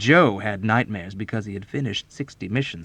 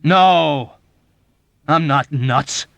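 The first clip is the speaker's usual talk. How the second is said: loud, drawn-out angry denial; high, forceful bellow; furious roar; 6 semitones higher, 11 dB louder, much slower than usual. A narrator's reading. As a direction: roar it back slowly. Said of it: "Dobbs roared furiously back".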